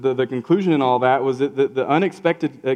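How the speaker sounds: ordinary talk, nothing marked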